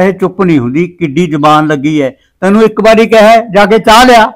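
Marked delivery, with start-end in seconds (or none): 2.4-4.3 higher, louder rude order. Acted. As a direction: bark the order at your wife. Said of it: "ਇੰਨਾ ਰੁੱਖਾ ਬੋਲਿਆ".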